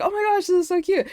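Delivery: high, quick squeal of delight; excited gushing. Retold or imitated. Girls gushing over the stickers, excited. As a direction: gush fast in a high pitch. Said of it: "like, really excited".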